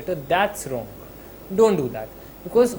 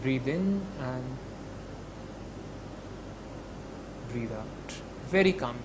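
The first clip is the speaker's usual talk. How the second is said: calm guided breathing; 5 semitones lower, 10 dB quieter than usual. Shown as a demonstration. Leading students through a breath, soft and calm. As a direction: guide the breath gently and softly. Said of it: "just go like this".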